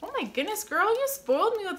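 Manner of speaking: happy surprise; delighted surprise. Real, not acted. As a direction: gush at the gift. More gifts revealed.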